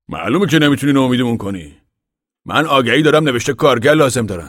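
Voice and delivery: a proud father of the family speaking out; Papa voiced. Papa addressing the gathered men, loud and earnest.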